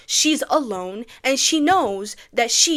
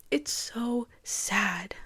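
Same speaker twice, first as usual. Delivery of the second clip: very slow, soft lament; sad; 6 dB quieter, much slower than usual. Real, not acted. Viewer upset at the child being blamed, slow and sad. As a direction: draw each word out quietly. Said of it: "I'm just so sad".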